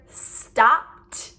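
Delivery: "stopped" slowly, ED as a crisp t; t ending. Shown as a demonstration. Teacher showing the t sound, slow "stopped" ending in released t. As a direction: release a separate t after "stopped". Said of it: "it will make the t".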